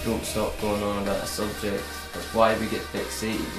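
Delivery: ordinary talk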